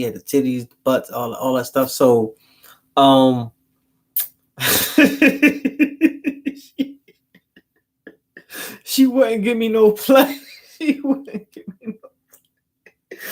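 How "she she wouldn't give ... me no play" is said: laughing through the words, high-pitched; words dissolving into real laughter. Real, not acted. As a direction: crack up mid-sentence and keep going. Cracks up telling his own story.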